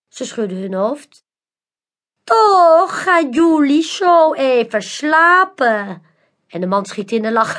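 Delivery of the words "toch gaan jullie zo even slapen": high, slow, theatrical magician voice; mysterious magician. A narrator's reading. Magician Manolo invites the crowd, slow and theatrical. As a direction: lure the crowd in like a showman.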